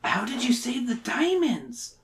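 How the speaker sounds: put-on kid's question. Child in story asks, reader voices.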